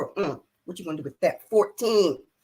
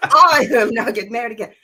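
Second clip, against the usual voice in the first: loud, insistent mock declaration; emphatic refusal; 2 semitones higher, 8 dB louder, faster than usual. Retelling how she refused to remarry, loud and emphatic. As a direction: lay down the law, playfully loud. Insists on her vow, retold.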